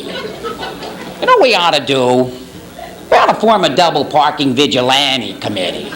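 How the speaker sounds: whining TV commentator, pitch sliding down; mock whiny curmudgeon impression; Andy Rooney. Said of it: "when he gets up there and he starts whining".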